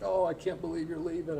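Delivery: high, hammed-up mother-in-law sobbing; imitating an overwrought goodbye; mock tearful farewell. Imitating the mother-in-law's tearful goodbye, high and hammed-up. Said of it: "it's like".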